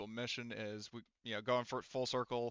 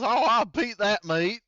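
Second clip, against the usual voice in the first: put-on loud, high, slow country-boy blurt; Texas hillbilly voice; 10 semitones higher, 14 dB louder, slower than usual. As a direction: belt it out like a proud rural Texan mangling the phrase. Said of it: "and Mike says".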